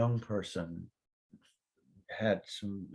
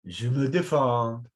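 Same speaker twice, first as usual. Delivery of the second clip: slow, resigned French phrase; French reply; 3 semitones higher, 6 dB louder, slower than usual. Retold or imitated. Quoting the Frenchman's stock answer, slow and resigned. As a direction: say it like a weary Frenchman. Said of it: "Every Frenchman knows".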